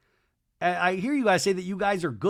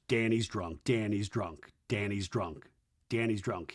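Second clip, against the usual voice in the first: low, softer, repeated like an aside; confidential aside; 6 semitones lower, 6 dB quieter than usual. Retold or imitated. Luke turns to Jesse about drunk Danny, low aside. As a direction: imitate a low word on the side.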